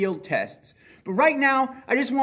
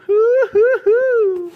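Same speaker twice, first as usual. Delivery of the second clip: playful hooting; 8 dB louder, much slower than usual. Acted.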